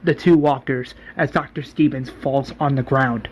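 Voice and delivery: plain reading voice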